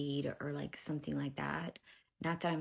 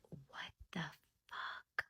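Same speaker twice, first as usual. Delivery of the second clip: acted whisper of disbelief, very soft; stunned whisper; 10 dB quieter, slower than usual. Mother hears witnesses saw her, stunned whisper. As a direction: mouth it almost without voice. Retold shock at witnesses claim.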